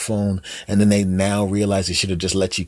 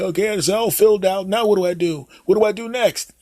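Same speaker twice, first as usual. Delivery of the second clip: put-on high, hesitant old dad voice; clueless dad; 12 semitones higher than usual. Dad calling his son Jim for phone help, high and unsure. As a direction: play the fumbling father asking for help. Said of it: "You go up to them".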